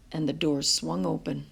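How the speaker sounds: usual speaking voice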